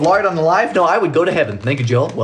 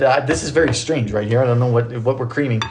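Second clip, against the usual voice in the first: ordinary delivery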